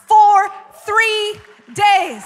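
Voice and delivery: for three days, stretched very slowly and shouted high; emphatic shout. Real, not acted. Preacher stressing the miracle to the crowd, loud and slow. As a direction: hammer every word of for three days out loud.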